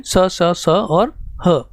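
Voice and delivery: स, स, स और ह said very slowly, one at a time; slow letter listing. Shown as a demonstration. Teacher to students listing sibilants, drawn out slowly. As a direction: spell out each sibilant slowly for the class. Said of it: "इनकी संख्या भी चार होती है".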